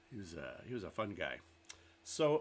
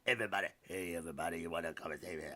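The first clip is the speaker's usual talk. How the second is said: a lighter, somewhat louder DJ patter, racing at the end; Wolfman Jack impression; 3 semitones higher, 5 dB louder than usual. Streamer replaying the DJ's on-air intro, lightly voiced DJ patter. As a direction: hype up the listeners like a radio DJ, lightly voiced. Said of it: "he'd be like".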